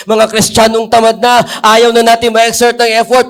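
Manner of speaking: fervent pulpit preaching that swells. Acted. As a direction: build the sermon up until it's ringing. Preaching builds to full force.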